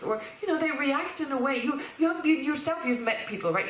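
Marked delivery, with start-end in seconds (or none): none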